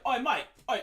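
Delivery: imitating a British manager yelling "Oi, mate"; British call. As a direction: shout it across the room like a British band manager. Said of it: "And obviously he's British".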